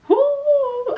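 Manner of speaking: high, loud drawn-out "woo"; loud whoop. Real, not acted. Exclaiming about the heels she traveled in, high whoop.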